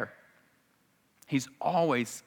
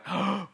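crowd gasp; 8 semitones higher than usual. Acted. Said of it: "all the people are like".

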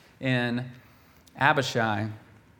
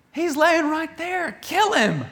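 high, excited urging voice; high eager soldier voice; eager whisper-like urging. Acted. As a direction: push him to strike, pitched way up. Abishai urges killing Saul.

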